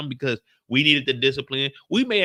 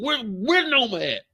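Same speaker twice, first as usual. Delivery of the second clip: usual speaking voice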